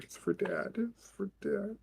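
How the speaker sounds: 哭腔低语; whimpering to himself, near tears; almost crying. Acted. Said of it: "almost crying to himself as he's putting it on".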